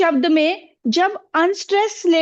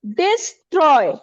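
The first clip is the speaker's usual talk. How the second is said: Destroy said slowly, Troy the stronger part; light डिस, then a firm Troy in destroy; stress on second syllable; slower than usual. Shown as a demonstration. Teacher repeats the example word, stressing Troy in destroy. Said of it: "ये unstressed है और ये stress syllable है".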